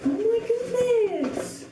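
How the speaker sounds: breathless surprise at the gift; amazed gasp. Real, not acted. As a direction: gasp it out in delight. Gasping at a gift unboxing.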